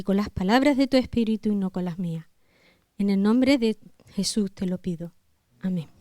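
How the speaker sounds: hushed, unhurried congregational prayer. Real, not acted. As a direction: pray softly and humbly, pausing between phrases. (oración pausada en voz recogida)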